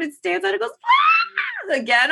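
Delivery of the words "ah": very high mock shriek; imitated pain scream. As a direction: scream like Mom putting weight on it. Imitates mother's second pain scream.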